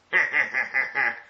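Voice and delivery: forced, evenly spaced dark chuckle; attempted dark chuckle. Shown as a demonstration. Reader trying out how to chuckle darkly, forced chuckle. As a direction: fake a sinister chuckle. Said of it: "how do you chuckle darkly?".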